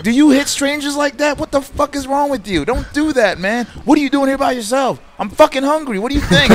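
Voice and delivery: high, loud, exasperated rant; mock outrage. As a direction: tell off the stranger who bugged you. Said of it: "I'm like".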